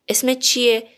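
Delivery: usual speaking voice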